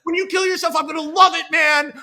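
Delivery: loud, high gleeful taunt. Retold or imitated. Jack taunting David about killing himself, loud gleeful shout. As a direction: shout it at him with mock glee.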